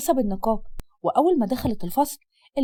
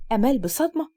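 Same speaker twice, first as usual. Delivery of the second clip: ordinary talk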